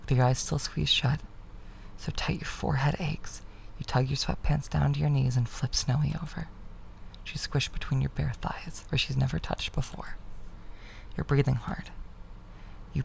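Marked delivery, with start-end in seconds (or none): none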